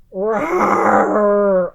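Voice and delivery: loud long angry roar; bear roar. A narrator's reading. Bear losing at dreidel, loud roar. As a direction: roar over the lost game. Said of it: "Old Bear roared".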